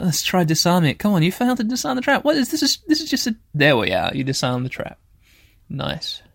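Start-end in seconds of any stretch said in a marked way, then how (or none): 1.0-3.4 high, fast frustrated complaint; exasperated. Real, not acted. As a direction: vent at the game. Failing the trap again.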